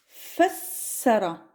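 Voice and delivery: fassara stretched out slowly; slow Arabic word. Shown as a demonstration. Teacher models the new Arabic word slowly. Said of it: "nous allons faire encore un troisième mot".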